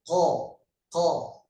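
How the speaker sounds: "Ko" demonstrated as a uvular q. Shown as a demonstration. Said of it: "pangkal lidah naik ke atas".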